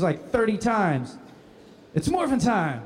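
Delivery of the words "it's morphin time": higher-pitched hero catchphrase; lightly acted action-hero rallying call; morph call. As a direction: call out the transformation for the camera. Re-enacting repeated takes of morph call.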